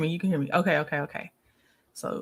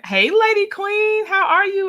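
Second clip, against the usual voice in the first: excited high greeting; 9 semitones higher, 6 dB louder than usual. Real, not acted. Greeting a regular viewer in chat.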